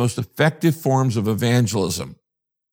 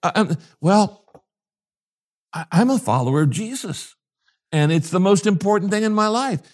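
flustered confession; 7 semitones higher than usual. Pretend. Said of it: "The guy's now going".

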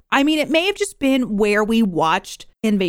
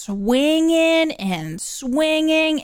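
very slow, stretched words, pitched up; drawn-out mimicry; 6 semitones higher, much slower than usual. Podcaster describing the priest on a swing, drawn out. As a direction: stretch each word like a long swing.

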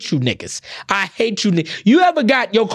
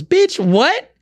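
incredulous; 10 semitones higher than usual. Retold or imitated. Reacting to the tow company's excuse, high incredulous yelp. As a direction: yelp in shocked disbelief.

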